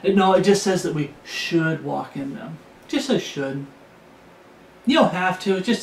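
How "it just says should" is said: mocking excuse. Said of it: "they get stuck on that word".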